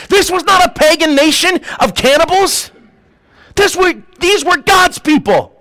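full-force preaching. Real, not acted. Preacher stressing these were God's people, full-force preaching. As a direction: drive it home to the congregation.

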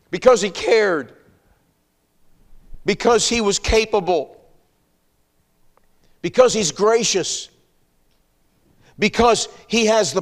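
high, loud, building sermon climax; rousing a congregation, loud and high; preaching at full force. Acted. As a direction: preach it hard, rising with each because.

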